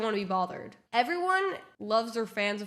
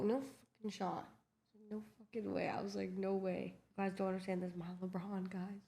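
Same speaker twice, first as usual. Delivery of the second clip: much softer, lowered voice in retold awe; hushed disbelief; 5 semitones lower, 12 dB quieter than usual. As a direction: say it under your breath, starstruck. Stunned at spotting her idol.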